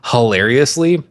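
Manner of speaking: usual speaking voice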